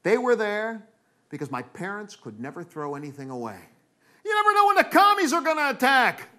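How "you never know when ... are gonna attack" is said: high, loud alarmed parent voice; hammed-up Cold War warning; paranoid parent. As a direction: cry it out like a panicky parent. Acted parent justifying hoarding.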